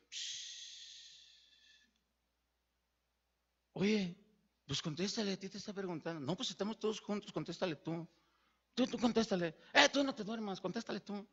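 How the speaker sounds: murmullo muy bajo entre ellos; cuchicheo nervioso. Retold or imitated. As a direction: se pasan la pregunta a escondidas, en voz baja. (fariseos cuchicheando, sin saber qué responder)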